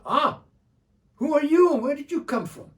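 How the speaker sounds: high, loud startled greeting; startled call. Acted. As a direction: jolt up and call out to the stranger. Bedou startled by stranger.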